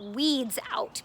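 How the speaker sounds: normal talking voice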